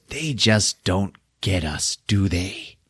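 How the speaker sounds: soft and drawn out, creepy confiding; Chucky. Retold or imitated. Evil doll to his adoring mother, slow soft menace. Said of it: "And then Chucky goes".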